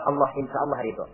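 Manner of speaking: low, soft resolution; hushed answer. Real, not acted. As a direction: drop down gently to close the couplet.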